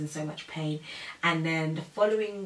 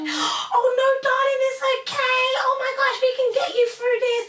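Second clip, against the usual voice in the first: very high, loud cooing; hammed-up fussy reassurance; gushing comforter; 9 dB louder than usual. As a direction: imitate an over-the-top consoling woman. Nurse comforting her, acted out.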